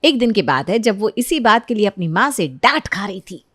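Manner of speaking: ordinary delivery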